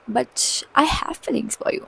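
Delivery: breathy whisper, no voice at all; hushed lover-boy whisper; whispered confession. Retold or imitated. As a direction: breathe it like a secret plea. Boy pleading his feelings.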